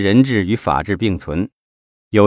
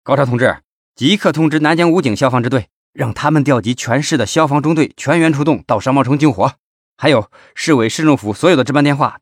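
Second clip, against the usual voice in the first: higher-pitched, pressing phone orders; raised, driving voice of command; urgent roar; 5 semitones higher than usual. A narrator's reading. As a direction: bark emergency orders down the phone. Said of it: "他吼道".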